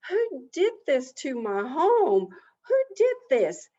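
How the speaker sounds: high, loud demand; outraged turtle wailing for the culprit; indignant outrage. A narrator's reading. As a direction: raise the pitch and volume in indignation. Turtle demands who wrecked home.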